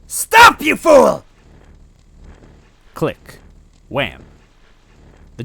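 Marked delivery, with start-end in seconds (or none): 0.1-1.2 extremely high, loud scream; furious shriek. A narrator's reading. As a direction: shriek at the idiot. Villainess screams at henchman.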